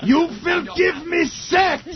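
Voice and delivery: very high, slow shouted German command; mock German accent. Mocking the German girl's commands, shouted mock German accent.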